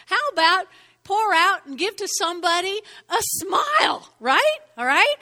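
loud, high preaching cry; preaching shout. Real, not acted. Preacher rousing the congregation with a loud high cry. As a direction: lift it up and shout it to the church.

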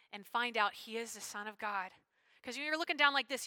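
ordinary delivery

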